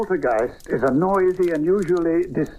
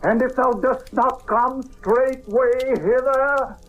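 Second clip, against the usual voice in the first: slow, rising ritual chant, high and ringing; solemn incantation; 9 semitones higher, slower than usual. Acted. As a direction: intone the summoning like a priest commanding a demon. Incantation summoning the spirit.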